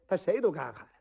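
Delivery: plain reading voice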